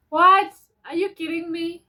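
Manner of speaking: sudden high loud "what?"; shocked disbelief. Real, not acted. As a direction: genuinely caught off guard. Reacting to her own letter.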